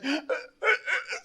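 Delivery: high startled gasp; mock gasp of surprise; imitated gasp. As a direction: act out the pastor's shocked gasp. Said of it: "cuando me ve le hace".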